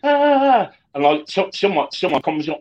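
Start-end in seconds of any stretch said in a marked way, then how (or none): 0.0-0.7 short, high, loud panicked shriek like a movie heroine; mock film scream. Podcast host mimics a panicking film heroine, mock scream. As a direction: yelp like the screaming woman in films. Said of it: "where the woman's screaming and".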